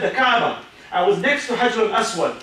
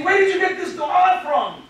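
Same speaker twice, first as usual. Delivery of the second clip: put-on mildly astonished old man, pitched up high; retold amazement; 8 semitones higher than usual. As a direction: imitate an old man's amazed question, higher.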